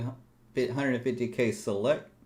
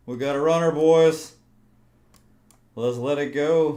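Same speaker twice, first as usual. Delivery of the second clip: excited shout; 6 semitones higher, 12 dB louder than usual. Real, not acted. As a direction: shout it out with glee. Excited as trade runs.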